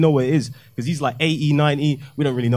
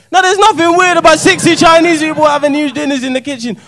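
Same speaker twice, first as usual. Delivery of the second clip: building loud; 11 semitones higher, 8 dB louder than usual. Real, not acted. Escalating repeated joke pattern.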